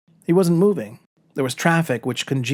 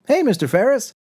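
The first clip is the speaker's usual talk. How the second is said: much higher and louder friendly hail; cheery call; 10 semitones higher, 4 dB louder than usual. Acted. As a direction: call out brightly across the hall. Janitor calls out in passing.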